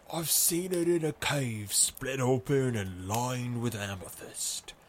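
a ghostly husky voice; husky revenant voice. A narrator's reading. Reefer telling the pub about the cave, husky revenant voice. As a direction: speak like a husky ghost recalling it. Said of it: "in a husky, revenant voice".

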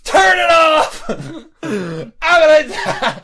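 high, loud, drawn-out wail; mock-panicked shout. Holding girlfriend's hand watching the fight, panicked shriek. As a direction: cry it out in hammed-up horror.

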